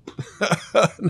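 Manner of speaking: quick amused laugh, high; a brief chuckle at the story; short laugh. Real, not acted. Preacher laughs at the crowd's empty approval.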